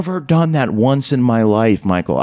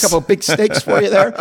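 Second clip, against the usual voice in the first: higher-pitched casual offer, on the edge of laughing; mock waitress offer; 7 semitones higher than usual. Server offering steaks to diners, mock chummy offer.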